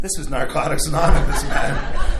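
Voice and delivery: loud, laughing retold protest; laughing exclaim. Retelling telling a guy off, loud and laughing. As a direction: laugh it out in mock disbelief.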